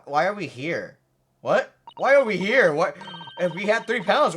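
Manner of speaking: usual speaking voice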